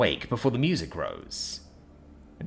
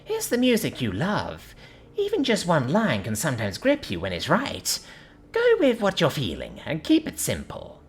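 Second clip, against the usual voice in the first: put-on young [name]; 5 semitones higher than usual. A narrator's reading. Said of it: "reminded him of a young [name]".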